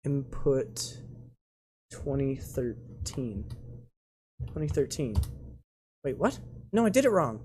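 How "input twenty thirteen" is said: softer, slow and drawn out; slow sounding-out. Real, not acted. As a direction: spell it out slowly while entering it. Slowly typing the input.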